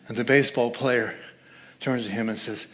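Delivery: ordinary talk, nothing marked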